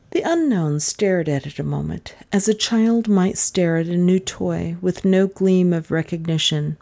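usual speaking voice

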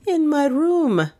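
high, drawn-out pert reply. A narrator's reading. Maid answering the detective's questioning, pert and high. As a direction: answer pertly, pitched well up. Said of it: "said the latter pertly".

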